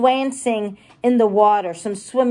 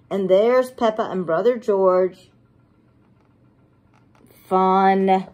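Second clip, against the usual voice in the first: usual speaking voice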